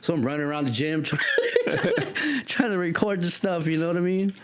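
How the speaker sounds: laughing while recalling running around filming. Real, not acted. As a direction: giggle as you tell it.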